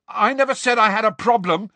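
high, loud indignant protest; heated. A narrator's reading. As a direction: protest hotly in self-defence. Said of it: "said Ron heatedly".

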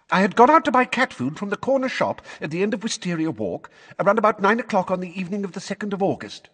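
high, fast rote recital; gabbled. Acted. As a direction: rattle it off as if learned by heart. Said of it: "gabbled Missus Figg at once".

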